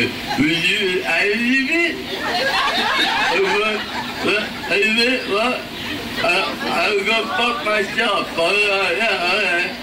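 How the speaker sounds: put-on garbled, high-pitched drunk mumbling; drunken pick-up attempt. Drunk man asking women to dance, slurred high babble. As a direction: slur it high, barely making words. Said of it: "I'm walking up to women and go".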